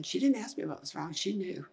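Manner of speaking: normal talking voice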